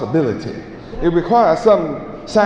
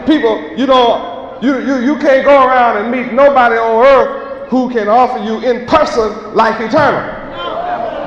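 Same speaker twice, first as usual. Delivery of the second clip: higher, louder call to the street crowd; shouted preaching; 8 semitones higher, 3 dB louder than usual. Acted. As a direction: shout the offer out to people passing.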